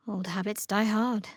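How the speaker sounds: usual speaking voice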